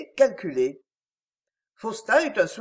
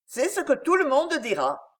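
firm, high and forceful; forceful retort; 7 semitones higher than usual. A narrator's reading. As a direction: hit back with force. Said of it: "répliqua Faustin avec force".

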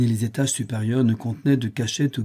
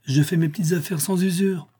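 high-pitched, anxious self-defence; timid, raised voice; frightened old man; 7 semitones higher than usual. A narrator's reading. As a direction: protest innocence fearfully. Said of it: "tout tremblant de peur".

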